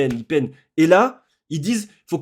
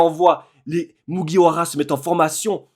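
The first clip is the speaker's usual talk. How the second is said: ordinary delivery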